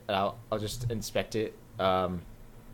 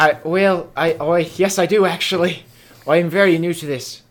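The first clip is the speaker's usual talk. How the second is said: flustered loud reply; 5 semitones higher, 12 dB louder than usual. Acted. Startled character, flustered reply.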